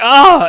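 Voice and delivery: sudden loud yelp as he hits his head; pained yelp. Acted. Narrating a drunk hitting his head, sudden yelp.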